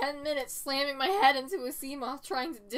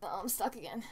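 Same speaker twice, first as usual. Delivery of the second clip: hushed whisper; 4 semitones lower, 6 dB quieter, faster than usual. Real, not acted. Stuck in game, hushed.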